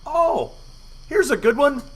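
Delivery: mock-cheerful drunk taunt; sarcastic taunt. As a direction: present it like a prize, gloating. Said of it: "And I go".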